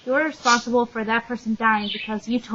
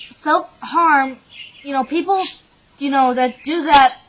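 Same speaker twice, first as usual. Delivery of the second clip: normal talking voice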